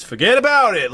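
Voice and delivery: Irish mobster with inflection swooping up high and loud; loud, high-pitched attempt at an Irish accent. Shown as a demonstration. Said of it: "With, with Irish".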